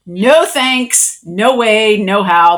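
loud, firm no-no-no; loud flat refusal, word by word; emphatic refusal. Retold or imitated.

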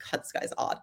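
breathy whispered thought to herself; whispered aside. Retold or imitated. Thinking about the odd froyo date, a whispered aside. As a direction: whisper it like a private judgment.

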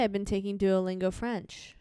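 normal talking voice

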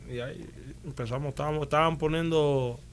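usual speaking voice

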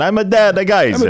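playfully mocking his own phrase in a strong Italian accent; hammed-up Italian accent. Shown as a demonstration. Host mocks his own catchphrase, jokey strong Italian accent. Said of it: "I just have a very strong Italian accent".

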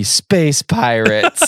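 slow, drawn-out SPACE PIRATES; hammed-up unveiling of SPACE PIRATES; grand reveal. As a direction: announce SPACE PIRATES with stretched, savoured words. Grinning reveal of the plan.

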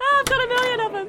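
gleeful. Acted. Gleeful boast.

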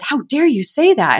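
a high, indignant scold; a hint of a shocked, angry grandma; mock outraged grandma. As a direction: play the offended grandmother.

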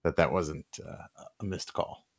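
deep, smooth late-night radio DJ; low, velvety on-air radio voice. Real, not acted. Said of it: "[name] sounding like a F M D J".

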